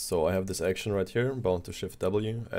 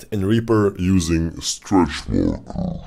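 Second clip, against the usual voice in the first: slowing and dragging down in pitch; like a tape grinding to a halt; tape stopping; 7 dB louder, slower than usual. Shown as a demonstration. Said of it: "just pull it down".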